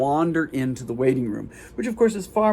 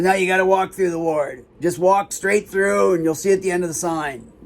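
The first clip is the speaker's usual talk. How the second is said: higher, put-on guard voice; guard giving directions; 5 semitones higher than usual. Guard sends visitor through the ward, higher imitated voice. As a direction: imitate a guard in a raised, carrying pitch. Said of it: "She goes".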